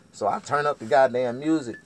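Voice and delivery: ordinary delivery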